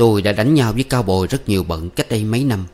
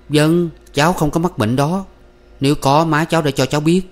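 higher-pitched, polite denial to an elder; earnest young man; 7 semitones higher than usual. Acted. Young man denying the illness to Bác Sáu, earnest. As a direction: answer the uncle earnestly, pitched up.

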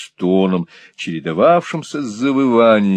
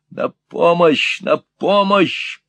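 higher, slow call for help; mock call for help; 8 semitones higher, slower than usual. A narrator's reading. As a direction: threaten to shout for the police. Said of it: "Я позову полицию".

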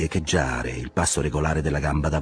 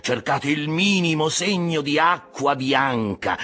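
raised voice slowing into a drawn-out final cry; fervent exhortation; 7 semitones higher, slower than usual. A narrator's reading. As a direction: urge the crew on, stretching the last command. Ahab urges crew to search. (esortazione accorata, sempre più lenta)

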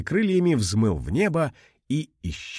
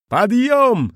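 sharp, much higher, louder order; command; 12 semitones higher, 6 dB louder than usual. Acted. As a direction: bark the order to take off. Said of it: "скомандовала Вжих".

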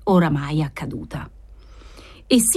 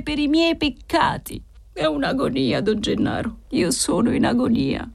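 whispered then higher, pained plea; weak, high voice of a dying man; feeble lament; 7 semitones higher than usual. A narrator's reading. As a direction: plead as one broken and starving. Exhausted prisoner pleads his agony.

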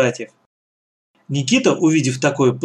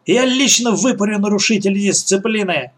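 high-pitched angry warning; shouted threat; 7 semitones higher than usual. A narrator's reading. Woman threatens captives who talk, shouting. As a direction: threaten them with a raised pitch. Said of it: "опять закричала женщина".

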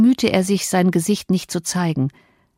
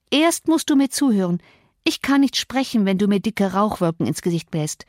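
put-on insistent young Cathy; 2 semitones higher than usual. Cathy wants her smoking cousin to listen, insistent. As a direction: insist on being heard.

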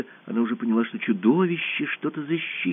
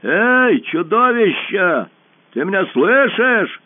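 high, loud, slow call; boy calling out to the monster; 7 semitones higher, 6 dB louder, much slower than usual. A narrator's reading. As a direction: shout to the hidden beast. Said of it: "и крикнул".

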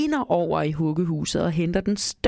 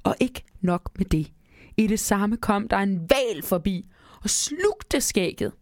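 usual speaking voice

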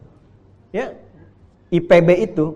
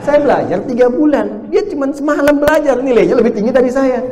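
retold indignant complaint; 9 semitones higher, 8 dB louder than usual. Preacher retelling an African student's indignant complaint about grades. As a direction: complain about the unfair grade, hammed up a little.

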